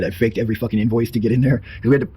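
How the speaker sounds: usual speaking voice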